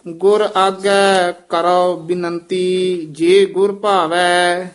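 continuous, slow chant through the line; scripture recitation. Shown as a demonstration. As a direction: recite it through, drawing out the endings. Full recitation before pause demo. (ਲਗਾਤਾਰ ਹੇਕ ਨਾਲ ਪਾਠ)

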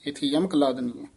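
ordinary talk, nothing marked

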